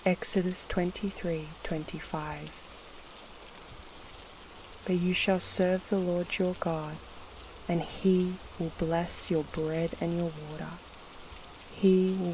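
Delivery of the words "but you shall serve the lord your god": usual speaking voice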